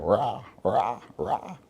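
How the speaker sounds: cheerleader chant of rah; mock cheer. Preacher mimics young people cheering, mock rally cry. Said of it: "young people jumping up".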